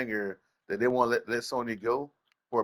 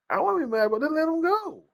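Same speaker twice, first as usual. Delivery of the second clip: scoffing. Real, not acted. Scoffs at another man's anger.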